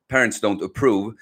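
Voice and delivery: ordinary delivery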